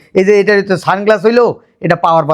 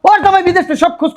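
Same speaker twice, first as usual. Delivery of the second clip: high-pitched ranting; worked-up, high-voiced complaint; yelling in argument; 9 semitones higher than usual. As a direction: raise the pitch sharply and rant. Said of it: "তুমি সব সময় চিল্লাও কি".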